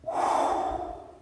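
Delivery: a long, breathy, unvoiced gasping inhale; deep breath in. Showing the huge breath he took at the mirror, long inhale. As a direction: pull in the deepest breath of your life. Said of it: "the deepest breath I've ever taken".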